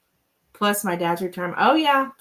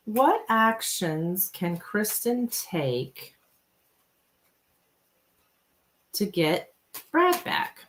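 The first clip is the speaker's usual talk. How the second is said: slow wording; much slower than usual. Shown as a demonstration. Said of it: "how are we gonna word this?".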